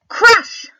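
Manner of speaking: "Crash" shouted high and loud; big voice on "Crash". Shown as a demonstration. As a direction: belt out "Crash". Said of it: "we need to say really loudly".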